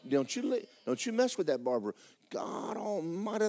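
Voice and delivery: usual speaking voice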